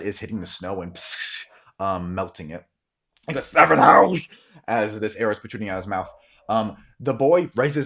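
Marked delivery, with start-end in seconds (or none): 3.5-4.3 loud, high, garbled cry; dying shout. Acted. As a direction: shout through a pierced mouth. Dying man cries out, arrow through mouth.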